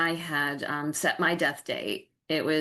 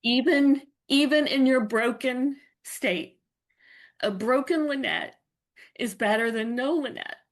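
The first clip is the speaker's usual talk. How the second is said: higher, tear-choked voice holding on; tearful; 7 semitones higher, 3 dB louder than usual. Real, not acted. Guest telling the host about her children, tearful and choked. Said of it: "I'm gonna try not to cry".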